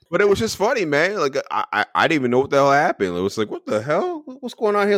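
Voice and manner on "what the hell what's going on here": mock surprise. Retold shocked reaction.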